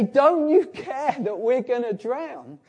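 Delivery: high, slow pleading cry of frightened men; panicked plea. Retold or imitated. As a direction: beg him to wake up and care. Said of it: "they say".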